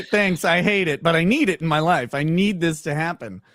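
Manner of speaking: ordinary talk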